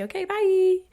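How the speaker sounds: bye tossed out high and loud; perky sign-off. Retold or imitated. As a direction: chirp the goodbye like a bubbly influencer. Quoting her own TikTok sign-off.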